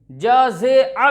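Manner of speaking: जजे अ drawn out as "jaa-zay-aa"; wrong-example long "jaa-zay-aa" for जजे अ; majhool vowel. Shown as a demonstration. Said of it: "भी नहीं बोलेंगे".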